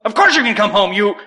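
loud, high scolding; exasperated retold outburst; mock angry mother. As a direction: imitate a mother cussing out her son. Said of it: "she cussed me out again".

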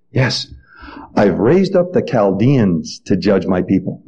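very low, understated divine reply; lightly voiced God. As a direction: answer calmly, dropped low as God. God's calm answer to prophet.

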